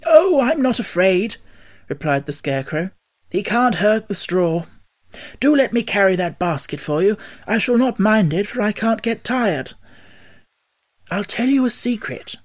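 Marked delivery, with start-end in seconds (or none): 0.1-1.4 high, loud, slow reassurance; unafraid. A narrator's reading. Scarecrow tells Dorothy he fears no dog, unafraid. 3.3-12.4 high-pitched, obliging straw man; eager Scarecrow. A narrator's reading. Scarecrow offers to carry Dorothy's basket, eager.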